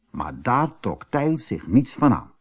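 usual speaking voice

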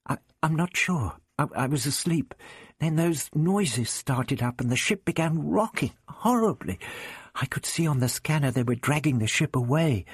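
put-on a dazed girl stammering her memory; lightly voiced frightened girl; shaken Vicky. A narrator's reading.

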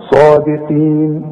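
صادقين with the final ي held two beats; صادقين held briefly at the pause; two-beat stretch. Shown as a demonstration. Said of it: "ممكن نقول".

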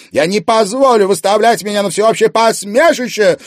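high, loud angry shout; furious public yell. A narrator's reading. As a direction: shout so the room hears. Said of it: "громко продолжала Анфиса".

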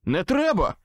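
high loud plea; shouting. A narrator's reading. Wizard begs Susan not to shoot, loud plea. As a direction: shout to stop her. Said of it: "прокричав я".

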